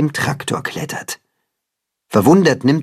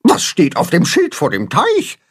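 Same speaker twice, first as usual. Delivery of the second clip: asking; 7 semitones higher than usual. Acted. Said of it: "fragt das Blumenhuhn".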